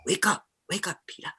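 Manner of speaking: a hint of mom waking dad at night; mock-urgent mom whispering to her husband; caricature of mom. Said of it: "it's a caricature".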